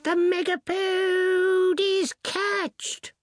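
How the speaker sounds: very high, very slow triumphant call; last hunting cry. Acted. Distant wizard announcing the catch, very high and slow. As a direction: drag out the triumph from far off. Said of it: "one last cry".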